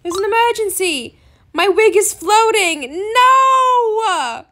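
loud, drawn-out hammed-up alarm; mock panic. Gamer hears Pig Power's wig floats, mock panic. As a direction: cry out as if disaster struck.

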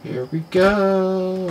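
usual speaking voice

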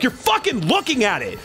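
high-pitched, outraged yelling; retold angry yell. Customer yelling at the airline agent, high and angry. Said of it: "I straight up yelled at her".

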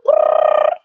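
rapper ad-lib brrr. Acted.

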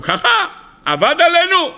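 high-pitched, affronted exclamation; indignant outburst. Acted. Officials learn they were tricked, indignant outburst. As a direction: react like someone who was just fooled.

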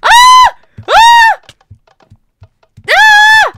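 very high, loud shriek of fright; short piercing screams, one after another; scared scream. Real, not acted. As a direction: scream in panic at the boss. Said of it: "I'm actually scared".

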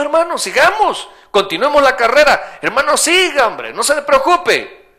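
high, loud rallying cry of an imprisoned apostle; rally the brothers from the jail cell; Paul encouraging. Acted. Paul in prison urging the believers outside, loud and high.

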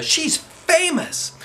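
put-on high, slow, starstruck girl; awed girl. A narrator's reading. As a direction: stretch it out in awe. Andrea voiced.

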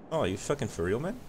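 ordinary talk, nothing marked

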